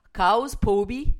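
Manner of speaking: prononciation galloise de « Chaos, Pobi », lente et appuyée; accent gallois. Shown as a demonstration. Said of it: "je vais essayer avec mon accent". (essai d'accent gallois, lent et appuyé)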